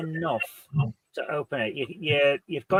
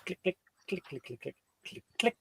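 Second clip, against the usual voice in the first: safe clicking sounds; 2 semitones higher, 14 dB quieter than usual. Acted. GM voices the safe mechanism.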